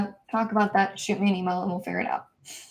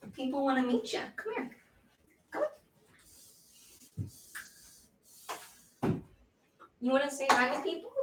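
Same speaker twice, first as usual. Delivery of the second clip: soft, higher coaxing; coaxing dog voice; 5 semitones higher, 8 dB quieter, faster than usual. Real, not acted. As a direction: sweet-talk the dog over.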